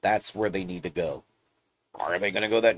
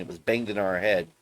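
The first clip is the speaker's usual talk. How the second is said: ordinary talk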